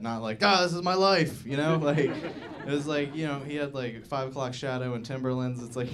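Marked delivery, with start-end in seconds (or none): 0.4-1.5 high, loud mock proclamation; exuberant outburst. Imagined transvestite embracing this life, exuberant outburst. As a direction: burst out louder and higher, hammed up. Contrasted acted reaction to own lifestyle.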